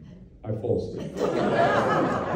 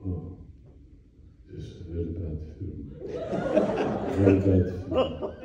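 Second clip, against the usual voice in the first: much lower, softer mock German actor grumble; grumbling old actor; 9 semitones lower, 5 dB quieter than usual. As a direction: grumble it low under your breath. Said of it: "And he starts going like this".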